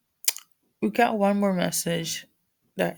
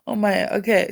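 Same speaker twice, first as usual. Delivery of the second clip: ordinary talk, nothing marked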